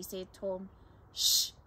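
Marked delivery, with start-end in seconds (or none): 1.1-1.5 a sharp, loud hush; sharp shush. Acted. Sister hushing her brother in the woods, sharp shush.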